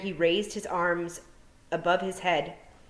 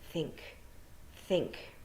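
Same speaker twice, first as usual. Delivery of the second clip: hushed thought; 9 dB quieter than usual. Acted. Tiffany's inner thought.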